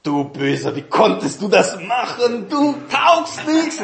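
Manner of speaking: höhere, spöttisch lachende Teufelsstimme; verhöhne den Sünder mit einem Lachen; anklagender Teufel. Retold or imitated. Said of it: "dann kommt der Teufel und sagt". (gespielter Teufel, der nach der Tat höhnt)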